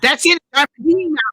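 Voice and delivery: loud, high-pitched outburst; shouting in exasperation at the dog; exasperated shout. Real, not acted. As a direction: yell it, totally fed up. Fed up with the dog.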